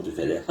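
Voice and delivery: long simulated laugh; fake laugh. Shown as a demonstration. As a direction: laugh on purpose for no reason. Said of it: "tu ris, euh, chez toi sans raison".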